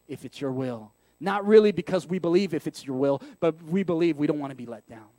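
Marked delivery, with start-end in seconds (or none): 0.1-0.9 low, subdued, resigned prayer; acted downcast hedging; glum. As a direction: say it braced for disappointment. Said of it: "in a very glum way".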